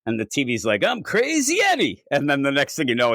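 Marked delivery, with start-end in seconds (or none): 0.8-2.0 high-pitched TV pitchman cry; mock yelled commercial. Predator parrots a TV commercial, high mock yell. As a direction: sing it out high like an ad. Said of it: "it's just yelling that throughout".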